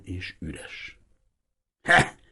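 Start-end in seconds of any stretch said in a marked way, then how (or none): 1.8-2.2 creaking grunt. Acted. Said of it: "recsent egyet az öreg Hiribi hangja".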